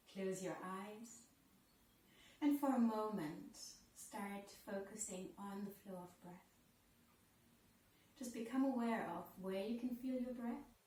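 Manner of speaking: soft, unhurried yoga teacher voice, with long pauses; guided breathing meditation. Real, not acted.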